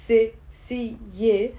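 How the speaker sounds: حساسية in separate syllables, ha, se, si, ye; broken down. Shown as a demonstration. Teacher breaking the word down, syllable by syllable. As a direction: pause after each syllable of حساسية. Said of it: "when I break it down".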